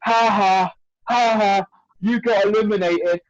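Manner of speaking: mock child's taunt; mocking kid. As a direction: jeer like a gloating little kid. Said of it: "he was going".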